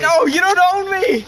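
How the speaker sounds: high, loud protest; shouting. Acted. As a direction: yell and pull away. Child resisting parent.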